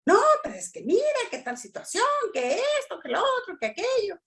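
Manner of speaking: high, fast and excited complaint; wife pouring out her grievances, high and fast; agitated wife. Acted. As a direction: rattle it off like an upset wife. Wife's agitated complaint, acted out.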